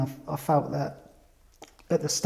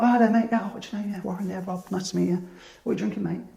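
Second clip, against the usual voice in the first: sociable pub regular; 5 semitones higher, 3 dB quieter, faster than usual. Retold or imitated. Ricky greeting everyone in the pub.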